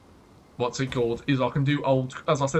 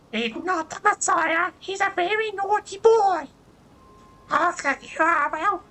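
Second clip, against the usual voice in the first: much higher, slow, falsetto shrieking mum; Monty Python lady voice; 4 dB louder than usual. Retold or imitated. Streamer imitating Monty Python's mum scolding, high falsetto. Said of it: "I can do a lady voice, but it's more like".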